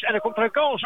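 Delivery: the attack grows, let the voice rise; excited call. Real, not acted. Chance repeated, voice climbs higher.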